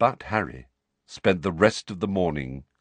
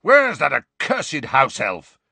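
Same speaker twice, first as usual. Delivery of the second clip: loud, slow, annoyed bellow; impatient shout for the elf; irritated yell; 6 semitones higher, 7 dB louder, slower than usual. Acted. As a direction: call out crossly through the house. Sirius yelling for the elf.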